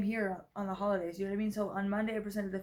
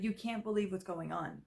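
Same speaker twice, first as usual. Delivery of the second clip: normal talking voice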